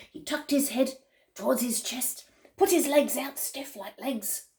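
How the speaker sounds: ordinary delivery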